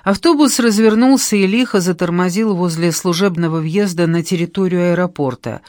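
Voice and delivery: ordinary delivery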